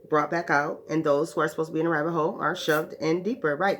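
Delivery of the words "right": normal talking voice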